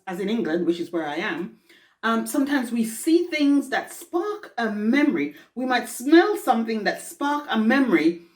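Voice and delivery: ordinary delivery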